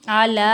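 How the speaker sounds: अला with the ला held long; three-count madd. Shown as a demonstration. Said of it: "इसको तीन second खींचेंगे". (अला को तीन सेकंड खींचकर)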